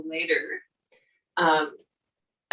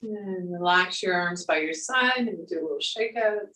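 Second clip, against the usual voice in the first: guided meditation. Acted. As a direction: guide them gently through the breath. Yoga teacher guiding breath and movement.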